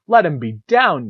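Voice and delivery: rising mock rage at the cartoon's writers; retold angry outburst. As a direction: rant at the TV, half-joking fury. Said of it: "I'm, like, actually angry".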